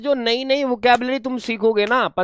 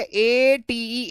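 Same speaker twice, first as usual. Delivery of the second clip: letters A T E drawn out slowly; dictating the spelling slowly; 4 semitones higher, much slower than usual. Shown as a demonstration. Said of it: "spelling verb form".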